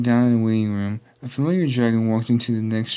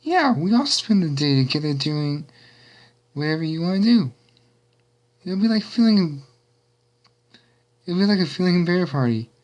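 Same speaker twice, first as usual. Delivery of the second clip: higher-pitched, excited character voice; excited shout; 7 semitones higher than usual. A narrator's reading. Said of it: "Pinkie shouted excitedly".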